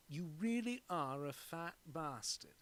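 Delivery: English accent with a broad "ah" in bastard. Shown as a demonstration. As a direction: put on an English accent, drawn out. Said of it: "Actually, with an English accent".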